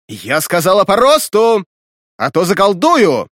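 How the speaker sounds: громко и выше, с угрозой; строго повторить приказ и пригрозить; командный окрик. A narrator's reading. Ведьма повторяет приказ, угрожая. (громкий приказ с угрозой)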